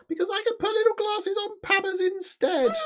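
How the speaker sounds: very high, squeaky cartoon voice; excited squeaky puppet pitch; high character voice. Voicing the sausage character Pablo.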